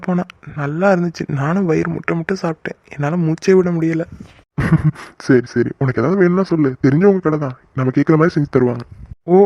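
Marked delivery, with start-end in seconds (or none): 4.6-5.2 brief amused laugh; short chuckle. Acted. Uncle amused by his young guests' meal praise, short chuckle.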